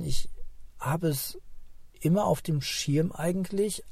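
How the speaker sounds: ordinary delivery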